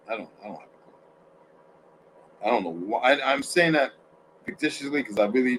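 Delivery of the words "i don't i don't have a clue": whispering. Real, not acted. Muttering to himself on stream.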